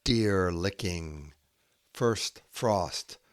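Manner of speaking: slow haiku reading with long pauses; poem reading. Acted. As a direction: let each image hang in the silence.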